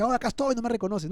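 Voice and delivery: hammed-up, high-pitched protest; mock indignant reply. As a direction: act out an exaggerated "don't you know me?" complaint. Acts out the reply Jesus didn't give.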